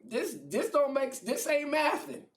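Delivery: high, laughing disbelief, slowed; can't make sense of it, half laughing; baffled Jesus. Acted. Jesus baffled by God's plan.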